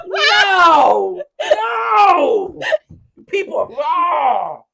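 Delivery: long, high, loud cries; horrified screaming. Real, not acted. Reacting to what was shown, screaming in horror. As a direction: wail each word out at full volume.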